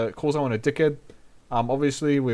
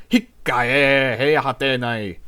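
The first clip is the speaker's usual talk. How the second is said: slow, louder frustrated exclamation; exasperated groan; 6 dB louder than usual. Shown as a demonstration. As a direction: drag it out when something goes wrong. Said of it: "Every time something goes wrong, I go".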